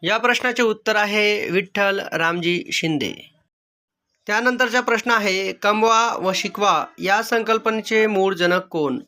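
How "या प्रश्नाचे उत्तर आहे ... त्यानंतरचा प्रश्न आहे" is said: ordinary talk, nothing marked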